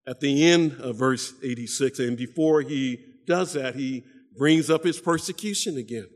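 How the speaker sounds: normal talking voice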